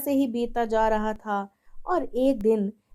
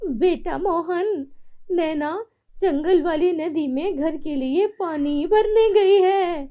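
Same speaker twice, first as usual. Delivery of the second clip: put-on old maid voice; 6 semitones higher, 5 dB louder than usual.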